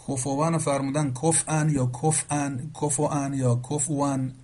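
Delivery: slow careful demo of کفوان with hamza, then with a still fa; variant readings of a word. Shown as a demonstration. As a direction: spell out each reading of کفوان distinctly. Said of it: "با همزه فرمودن، با سکون فا فرمودن".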